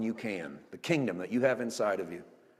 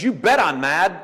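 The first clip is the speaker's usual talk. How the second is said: loud, higher acted retort; mad Jonah; 5 semitones higher, 8 dB louder, slower than usual. Jonah answering God about the tree, loud acted anger. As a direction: fire back indignantly as Jonah. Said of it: "he's mad again".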